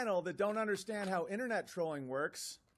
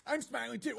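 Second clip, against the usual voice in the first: put-on high whiny eight-year-old parody; childish mock voice; 4 semitones higher than usual. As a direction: play him as a sulky kid. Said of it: "mocking the critic".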